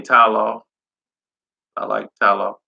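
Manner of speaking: ordinary delivery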